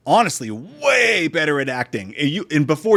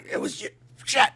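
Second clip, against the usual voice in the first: hole-in-the-trachea voice; 9 semitones higher than usual. Acted. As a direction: wheeze it out with no voice. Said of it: "he has a hole in his trachea".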